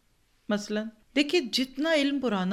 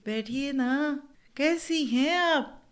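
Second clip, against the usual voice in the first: slow, gracious invitation to sit; courteous welcome; 5 semitones higher, much slower than usual. Shown as a demonstration. Teacher demos polite hosting, slow and courteous. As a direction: welcome the guest warmly and unhurried. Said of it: "तकल्लुफ़ है मेरी ज़ुबान में".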